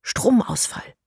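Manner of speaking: Stromausfall whispered, voiceless and secretive. A narrator's reading. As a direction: breathe the word Stromausfall as a secret. Plotters whisper the next phase. (Stromausfall geflüstert, ohne Stimme)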